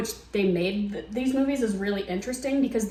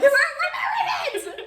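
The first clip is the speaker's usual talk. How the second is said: much higher, shouted through laughter; Pearl voice. Acted. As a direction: shriek it like a frantic Pearl. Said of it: "She was really giving Pearl".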